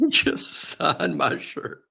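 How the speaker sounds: laughing. Retold or imitated. Cohost mimics kid laughing.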